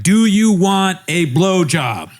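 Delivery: slow, high mock proposition; exaggerated come-on. Co-host imagines her making a blunter offer, exaggerated and slow. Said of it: "what if it was even more like".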